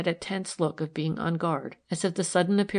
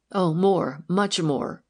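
crying out; 4 dB louder, slower than usual. A narrator's reading. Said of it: "cried the woman".

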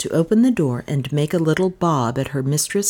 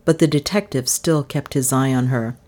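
usual speaking voice

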